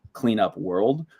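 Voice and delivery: ordinary talk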